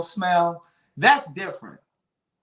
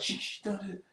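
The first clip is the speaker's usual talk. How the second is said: hushed warning; 3 semitones higher, 13 dB quieter than usual. Retold or imitated. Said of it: "Keon was like".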